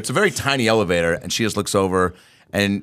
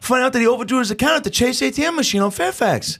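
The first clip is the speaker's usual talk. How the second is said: high, strained Nick Cage voice; Nick Cage impression. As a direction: pitch it up like a frazzled Nick Cage. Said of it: "Here's Nick Cage".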